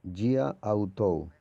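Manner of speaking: Gia autou read very slowly, gia stretched and run into autou; Greek elision demo. Shown as a demonstration. Teacher showing the class Greek elision, slow and drawn out. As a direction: sound out Gia autou slowly so the vowels blend. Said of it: "En el griego también existe este elemento".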